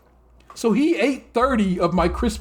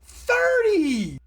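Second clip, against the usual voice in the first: outraged exclamation; 9 semitones higher than usual. Acted. Co-host appalled that a husband ate her chocolate, outraged. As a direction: cry it out high in comic disbelief.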